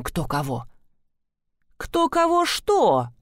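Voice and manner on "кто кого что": slow suspicious question. Acted. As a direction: ask it slowly, full of suspicion. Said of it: "подозрительно уточнила я".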